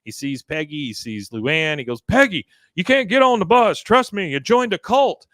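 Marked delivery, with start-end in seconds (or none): 2.0-5.3 loud, high urgent plea; urgent yelling. Retold or imitated. Husband yelling at his wife not to board the bus, loud and urgent. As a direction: yell a warning at her.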